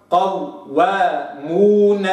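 قوامون very slow, the shadda waw kept separate from the vowel; doubled waw demo. Shown as a demonstration. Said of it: "هكذا".

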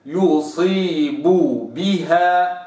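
slow يصيب بها, both ب closed; slow careful recitation; lips fully closed. Shown as a demonstration. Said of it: "شوف".

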